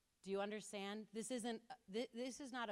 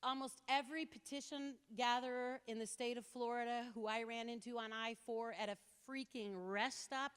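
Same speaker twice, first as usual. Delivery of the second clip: ordinary talk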